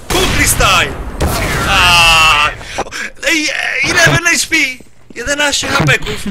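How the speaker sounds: loud, high shouting near-miss; frightened shriek. Real, not acted. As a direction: yell it out, frantic. Near-miss shout, eleven HP left.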